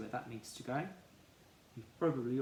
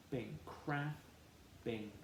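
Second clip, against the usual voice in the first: slow sounding out; 5 dB quieter, much slower than usual. Shown as a demonstration. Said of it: "and we say k".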